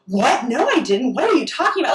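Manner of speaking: mock-clueless denial, pitch leaping up; feigned bewilderment. Accused comic denying it to heckler, acting clueless. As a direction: imitate someone playing innocent. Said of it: "he acted like he had no idea".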